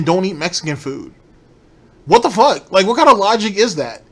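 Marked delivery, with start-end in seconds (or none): 2.1-4.0 loud, high what the fuck; real exasperated outburst at the logic; outraged disbelief. Real, not acted. Streamer ranting at the couple's reasoning, loud outrage.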